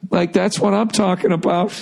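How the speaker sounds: high-pitched, approving imitation.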